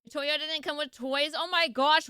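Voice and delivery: ordinary talk, nothing marked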